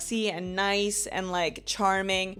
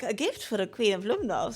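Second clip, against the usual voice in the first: lightly put-on formal vampire courtier; courtly gift-bearer voice; 2 semitones higher than usual. Vampires greeting Bella in Breaking Dawn, lightly mock-formal. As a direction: offer the gift with mock ceremony. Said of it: "and they were like".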